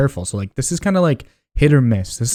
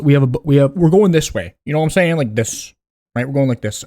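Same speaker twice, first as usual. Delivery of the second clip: ordinary talk, nothing marked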